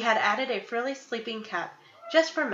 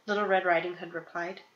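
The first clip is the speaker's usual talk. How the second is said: usual speaking voice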